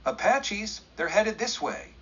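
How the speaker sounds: high, breathless alarm; gasped warning. A narrator's reading. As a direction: blurt the warning in panic. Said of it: "he gasped".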